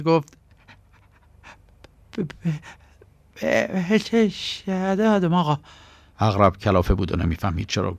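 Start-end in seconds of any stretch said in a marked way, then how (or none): 2.2-5.6 higher, slow, stuttering start; a frightened boy tripping over his words; shaky stutter, much higher; stammering fear. Acted. Terrified boy stammers out answer.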